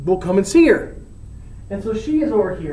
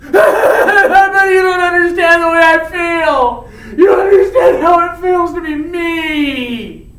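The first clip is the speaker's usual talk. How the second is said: higher, loud whiny mock shout; mocking Romeo; 6 semitones higher, 6 dB louder than usual. Friar mimics Romeo's whining with a loud mock wail. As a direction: parody a sulking teenager. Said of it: "friar mocking Romeo's whining".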